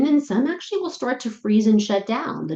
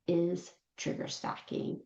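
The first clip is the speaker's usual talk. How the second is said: hushed emphasis; 12 dB quieter than usual. Real, not acted.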